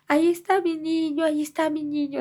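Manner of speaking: high, wailing imitation of a grandmother's cry; retold anguished cry. Grandmother sees the boy's body, imitated horrified wail. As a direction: cry out as if spotting the lost child. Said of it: "mi mamá empieza a gritar".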